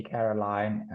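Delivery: ordinary talk, nothing marked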